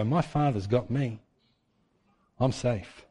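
soft, quiet, trusting; calm trust. Retold or imitated. Believer resting in God, soft and trusting. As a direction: rest easy in your Father's care.

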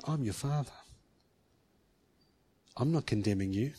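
soft, low fatherly reassurance; soft voice of a loving father; gentle reassurance. Retold or imitated. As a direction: comfort them quietly. God speaking gently.

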